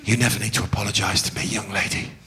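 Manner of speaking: low, hushed sincerity; earnest reassurance. Acted. Teacher reassuring his favourite pupil, low and earnest. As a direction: drop right down, measured and intimate.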